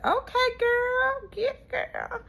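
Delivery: high, slow hyped-up squeal; squealing support for the couple; high excited cheer. Retold or imitated. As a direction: cheer her on like a hype friend. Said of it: "And I said".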